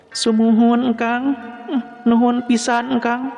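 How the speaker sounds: high-pitched, polite female voice; young woman character. A narrator's reading. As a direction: answer gratefully in a high feminine voice. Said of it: "Jawab eta istri". (sora istri luhur tur sopan)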